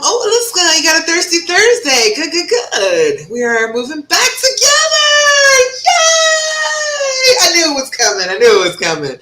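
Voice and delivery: high, loud squeal of delight; celebratory whoop, voice way up; excited cheer. Real, not acted. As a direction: cheer for her big news at full pitch. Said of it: "I'm so excited".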